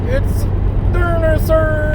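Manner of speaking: sudden high, loud exclamation; excited shout. Real, not acted. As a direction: shout it out in surprise. Spots a dinosaur while driving.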